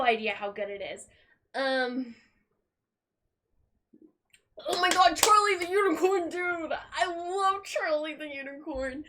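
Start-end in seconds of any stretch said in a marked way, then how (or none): 4.7-9.0 excited gush. Real, not acted. Fan gushing to viewers about Charlie, excited and high. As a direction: burst out with delight. Gushing over a favourite series.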